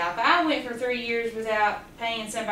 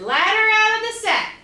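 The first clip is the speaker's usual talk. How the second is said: high, louder order to the sack; commanding call; 8 semitones higher, 5 dB louder than usual. Acted. Quackling ordering his ladder out of the sack. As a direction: sing out the command with glee.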